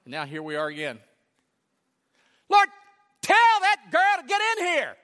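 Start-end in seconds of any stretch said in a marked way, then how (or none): 2.5-5.0 high, loud, fed-up order; exasperated demand. Retold or imitated. Martha demanding Jesus send her sister in. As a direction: insist he make her come.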